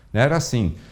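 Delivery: usual speaking voice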